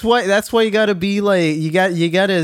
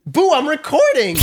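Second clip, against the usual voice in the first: calling out; 8 semitones higher than usual. Real, not acted. Calls out to Boo off mic.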